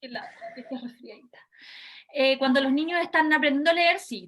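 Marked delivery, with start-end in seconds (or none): 0.0-1.4 soft whisper. Real, not acted. Teacher sympathizes with student.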